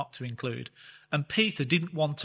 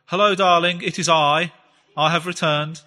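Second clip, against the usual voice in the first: louder, pompous mock-formal greeting to his wife; mock grand announcement; 2 semitones higher, 8 dB louder than usual. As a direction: play it like a hammed-up stage hero arriving home. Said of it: "people don't talk like that".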